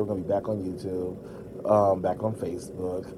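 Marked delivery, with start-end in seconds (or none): none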